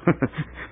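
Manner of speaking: soft villainous snicker; gloating unvoiced laugh; breathy evil chuckle. Villain gloats over a murder.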